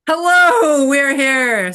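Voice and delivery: high, loud, drawn-in hello falling at the end; called-out greeting. Real, not acted. Host hailing listeners after a laugh, loud high call. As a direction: sing out the hello loudly and high.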